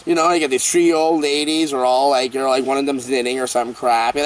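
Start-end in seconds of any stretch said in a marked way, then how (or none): none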